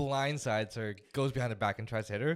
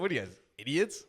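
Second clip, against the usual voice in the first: laughing mock scorn; 4 semitones higher than usual. Mocking grandma's scorn.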